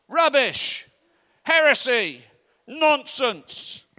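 loud, high outraged shouts; furious heckling shout. Retold or imitated. Old pensioner heckling the preacher, furious shouting.

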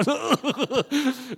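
a short high laugh at the memory; high nervous laugh. Real, not acted.

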